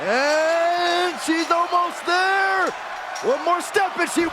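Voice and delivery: high, shouted match call with stretched words; thrilled wrestling play-by-play; building excitement. Acted. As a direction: draw out the suspense at a shout. Commentator calling a near finish.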